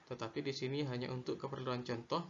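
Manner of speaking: ordinary talk, nothing marked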